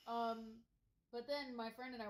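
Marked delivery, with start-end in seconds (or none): none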